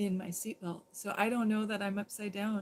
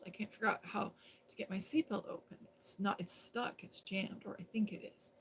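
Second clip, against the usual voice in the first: ordinary talk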